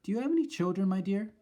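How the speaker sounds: high, sweetly polite; sweet grandmother. Acted. Grandmother politely probing the witch, high and sweet. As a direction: ask it innocently.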